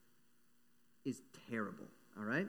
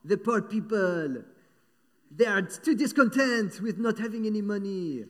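haughty Marie Antoinette; 6 semitones higher, 9 dB louder than usual. Retold or imitated. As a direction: sneer at the poor from above. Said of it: "She's like".

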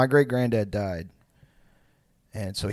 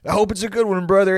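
higher, hearty imitated buddy; mock well-wisher; 7 semitones higher, 4 dB louder than usual. Friend wishing dad well, higher mock-hearty voice. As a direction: imitate an oblivious pal's birthday wishes. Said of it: "And they were like".

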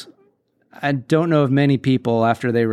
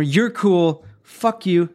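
higher, playful cursing; mock picking customers; 5 semitones higher, much slower than usual. Cook sorting customers into cool and not, playful. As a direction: spare one customer, curse the next. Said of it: "Points to a customer".

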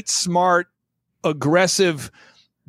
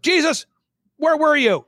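higher calling voice; worried mother; 9 semitones higher than usual. Retold or imitated. Retelling Mary finding Jesus, higher worried mother's call. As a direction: call out for a missing son, higher pitch.

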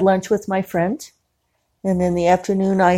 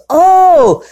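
manager's reaction; 12 semitones higher, 8 dB louder than usual. Retold or imitated. Manager reacting, much higher, louder.

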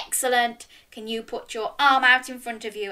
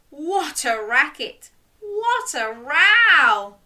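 exclaiming at noise; 6 semitones higher, 5 dB louder, slower than usual. A narrator's reading. Animals' din exclaimed in story.

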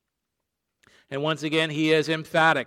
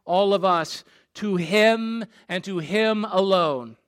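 ordinary delivery

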